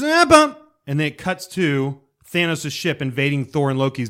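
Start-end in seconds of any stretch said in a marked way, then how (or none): 0.0-0.6 much louder, much higher blast; hammed-up title sting; mock fanfare hit. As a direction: punch it like the logo sting. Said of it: "And then it goes".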